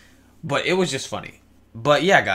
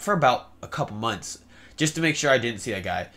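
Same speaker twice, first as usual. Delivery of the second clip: ordinary delivery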